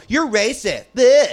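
mock childish accuser.

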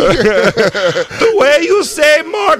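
high, loud, slow weepy praise; mock emotional judge. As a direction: gush tearfully, slowly.

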